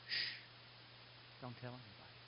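very soft, low hush. Retold or imitated. Jesus telling the parents to keep quiet, hushed. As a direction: keep it secret, barely voiced.

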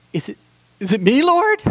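"Is it, is it me, Lord?" rising high and quick in alarm; a disciple's fast, rising plea of dread; anxious Peter. Acted. Peter at the supper fearing he is the betrayer, high rising plea.

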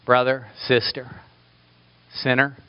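ordinary delivery

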